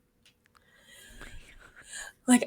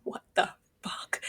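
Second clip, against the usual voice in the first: stunned whisper; whispered disbelief; 4 dB quieter than usual. Retold or imitated. Reader whispering shock at another plot twist. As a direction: breathe it out in disbelief. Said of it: "And you're just like".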